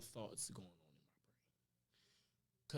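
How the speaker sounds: whispered. Retold or imitated. Recalling dark thoughts on balcony.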